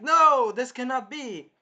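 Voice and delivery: high, loud, drawn-out protest; mock anguished king; king's disbelief. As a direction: cry out in hammed-up royal dismay.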